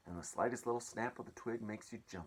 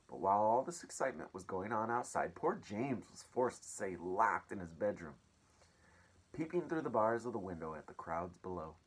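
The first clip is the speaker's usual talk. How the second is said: plain reading voice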